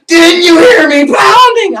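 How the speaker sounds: high, loud, slow screech; furious scolding. Retold or imitated. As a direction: screech the accusation, imitating an enraged coworker. Acts her furious question.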